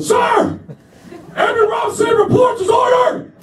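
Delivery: very high, strained full-voiced yell; hammed-up shouted military reporting; over-loud recruit. Trainee told to be loud reports to sergeant, overdone yelling. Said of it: "my recruiter told me to be loud".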